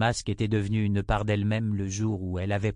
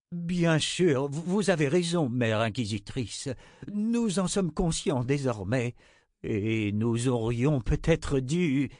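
voix de bonhomme timide, hésitante, qui traîne sur les mots; a meek chancellor, dragging out his agreement; chancelier timide; 4 semitones higher, slower than usual. A narrator's reading. (chancelier timide et hésitant)

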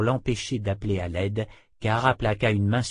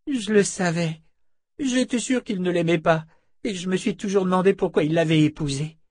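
put-on high young voice, relieved; a teenage boy, voiced higher; boy's voice; 8 semitones higher, 3 dB louder than usual. A narrator's reading. Boy learning Gadi died, high and relieved.

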